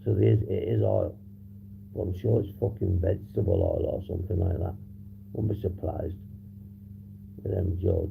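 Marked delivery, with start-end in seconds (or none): none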